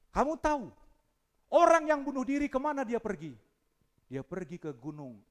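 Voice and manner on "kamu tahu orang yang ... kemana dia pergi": high, loud demanding question; loud challenge. Retold or imitated. Pastor confronting the boy, loud high challenge. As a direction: fire it at him.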